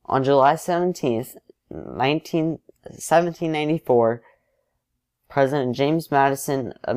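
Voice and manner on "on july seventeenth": plain reading voice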